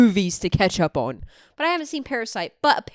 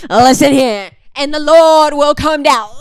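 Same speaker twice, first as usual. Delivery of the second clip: loud, higher church-clip preacher impression; shouting preacher; 6 semitones higher, 13 dB louder than usual. Imitating the guy from a church clip, shouting preacher. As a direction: belt it out like a fired-up preacher. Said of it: "There's a church clip of the guy".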